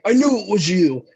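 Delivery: high, loud gotcha outburst; mock accusation. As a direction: pounce on the culprit, imitating the accusers. Superiors accuse the laughing culprit.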